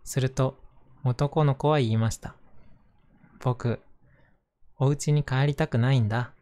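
gentle storybook narration voice; reading to children. Acted. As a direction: read it aloud to little kids. Reads a picture book aloud.

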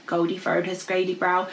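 plain reading voice

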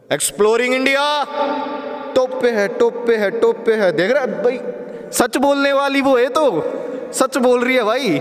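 calling out to make it echo; loud echoing shout; cave echo. Shown as a demonstration. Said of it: "देखो, सुनाते हैं।".

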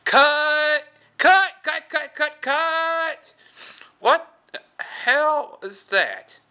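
shrieking film director, very high and loud; Crypt Keeper. Acted. Director stopping a bad take, high outraged shriek. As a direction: yell rapid-fire cuts, then a drawn-out outraged question. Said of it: "the Crypt Keeper ha-, is director".

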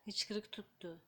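whispered Hıçkırık tuttu. Real, not acted. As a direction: hush it to yourself. Whispers baby has hiccups.

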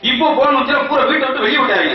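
ordinary talk, nothing marked

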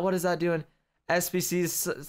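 usual speaking voice

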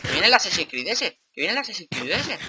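high, loud warning with swooping tune; excited alarm. Real, not acted. Player warning that the attacker is coming, high and agitated. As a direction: sound the alarm, voice jumping up. Enemy approaching in game.

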